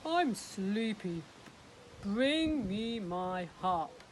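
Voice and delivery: slow, loud drowsy giant; sleepy giant. Acted. Sleepy giant asking his wife for the harp. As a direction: yawn it out like a tired giant. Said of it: "said the giant".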